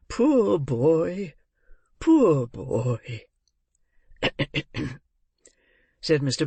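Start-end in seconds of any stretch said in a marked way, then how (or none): 0.1-3.2 lightly voiced old gentleman, drawn out and swooping; a touch of pitying emotion, words stretched; choked-up old gentleman. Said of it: "clearing his throat". 4.2-4.9 gruff little throat-clear; short acted cough. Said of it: "clearing his throat".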